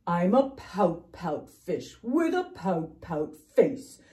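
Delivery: loud dreary chant, sinking into low blubs; dramatic gloomy fish refrain; glum pout-pout fish. Acted. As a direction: declaim the gloom, then bubble down. Voices the fish's refrain.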